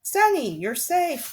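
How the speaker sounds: high, loud joyful cry; relieved cry. Acted. Klaus crying out to his rescued sister. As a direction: burst out in relief. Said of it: "Klaus cried".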